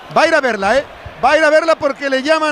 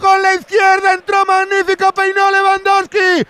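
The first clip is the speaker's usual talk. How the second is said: excited commentary; 5 semitones higher than usual. Real, not acted. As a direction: shout the play as it happens. Commentator calls the goal live.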